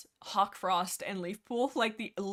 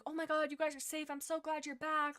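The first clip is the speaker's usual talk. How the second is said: higher-pitched retold relief; relieved greeting; 7 semitones higher than usual. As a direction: voice his glad relief, pitched up. Retold relief at friends' safe return.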